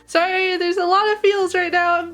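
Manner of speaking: overwhelmed by feels, pitch soaring; emotional high voice. Real, not acted. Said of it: "My voice is getting higher and higher pitched".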